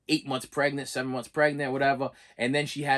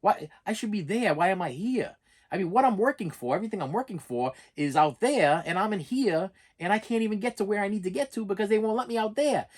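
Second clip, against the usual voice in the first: higher pitched, exasperated retold outburst; getting worked up; 6 semitones higher than usual. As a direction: replay the frustration at being stuck at work. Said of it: "I'm getting worked up".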